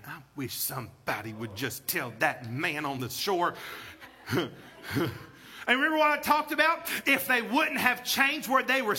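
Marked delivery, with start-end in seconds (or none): 0.1-4.5 wry aside. Real, not acted. Low aside with laugh.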